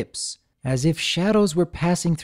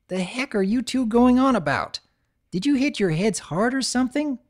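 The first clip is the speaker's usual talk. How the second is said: annoyed tomboy; 10 semitones higher than usual. Acted. Scootaloo annoyed.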